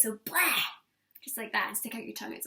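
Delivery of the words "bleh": open-faced bleh, spitting it out; loud bleh. Shown as a demonstration. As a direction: spit it out with a wide-open face. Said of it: "make sure you open up your whole face".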